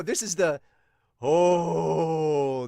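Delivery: ordinary talk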